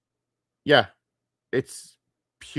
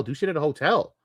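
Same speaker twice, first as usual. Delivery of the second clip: ordinary talk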